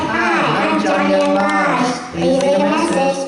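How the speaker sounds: very high mock recording voice; exaggerated high-pitched outgoing greeting; squeaky voicemail voice. Recording a first voicemail greeting, absurdly high voice. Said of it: "but it sounds like this".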